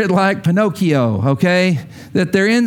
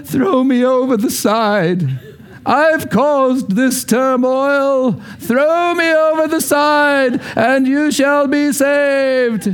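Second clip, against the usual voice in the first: slow, grand hero's voice, higher; heroic storybook Jonah; 7 semitones higher, slower than usual. Retold or imitated. Jonah as children hear him, slow and heroic. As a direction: play noble Jonah from the kids' version. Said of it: "to the kids and they hear Jonah go".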